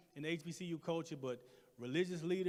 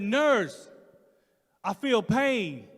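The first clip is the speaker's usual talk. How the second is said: louder, slow pained plea; 4 semitones higher, 12 dB louder, much slower than usual. Retold or imitated. Patient pleading with the nurse, slow and pained. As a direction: drag it out in pain. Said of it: "I said".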